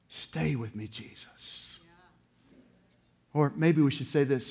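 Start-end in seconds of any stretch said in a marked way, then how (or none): 0.1-1.8 soft, low prayer fading to breath; whispered prayer. Acted. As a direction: pray it under your breath. Prayer said softly.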